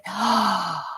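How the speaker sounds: long, soft audible gasp. Real, not acted. Reacting to her friend before pleading, soft gasp.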